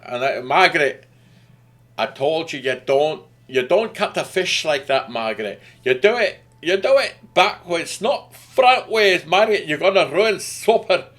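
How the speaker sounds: loud, high-pitched scolding of Margaret in a badly faked accent; hammed-up accent, building to a loud, high rebuke; terrible put-on accent. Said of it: "that was a terrible accent".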